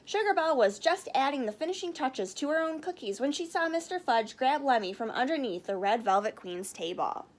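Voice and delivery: plain reading voice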